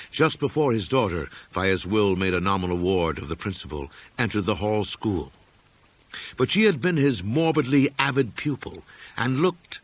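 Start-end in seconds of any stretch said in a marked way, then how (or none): none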